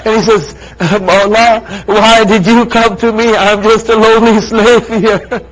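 loud, high, rising majlis preaching; impassioned preaching. Acted. Preacher driving a mourning crowd to climax, impassioned. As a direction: build the grief to a peak.

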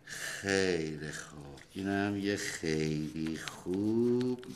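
drawn-out words, sinking low at the end; slow grading praise. Acted. Father marks child's homework like teacher, slow praise. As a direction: say it slowly as you write the mark.

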